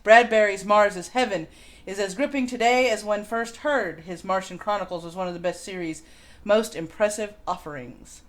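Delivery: ordinary talk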